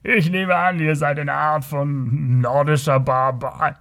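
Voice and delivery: slow, condescending put-on villain voice; scornful Kothian rogue. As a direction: size up the foreigner with drawn-out contempt. (herablassender, gedehnt sprechender Kotier)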